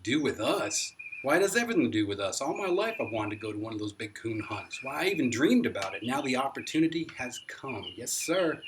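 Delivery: bursting with a lifelong dream; almost shouting. A narrator's reading. Excited grandpa nearly shouts at the boy about the hunt, loud. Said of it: "and almost shouted".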